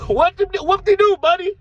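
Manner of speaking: high, loud mock challenge; confrontational. Storyteller mocking the Louisiana guy's challenge, confrontational.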